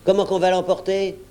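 exasperated mimicking retort; indignant echo. A narrator's reading. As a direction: throw his question back at him. Silver throws George's question back.

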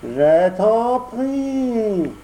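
high odd echoing cat voice. Acted. Cat echoes the fox in a high whine. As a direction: parrot the fox's last word. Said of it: "répéta le chat".

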